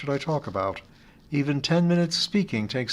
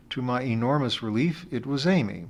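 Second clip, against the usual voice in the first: usual speaking voice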